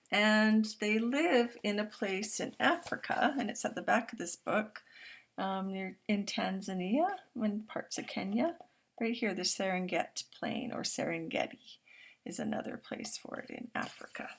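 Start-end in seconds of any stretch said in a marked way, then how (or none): none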